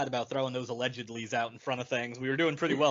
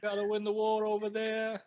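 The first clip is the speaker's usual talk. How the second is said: put-on New York accent; 10 semitones higher than usual. Continuing the New York bit.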